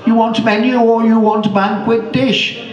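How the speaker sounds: high, eager waiter calling out. Acted. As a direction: pitch it up like a keen waiter. Waiter calling to old lady.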